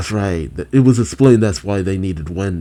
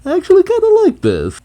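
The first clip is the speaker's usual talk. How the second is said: very high, fast, louder burst of delight; excited squeal; 5 dB louder, faster than usual. Real, not acted. Host reacting to lightning powers, delighted squeal. Host gushes over the twist.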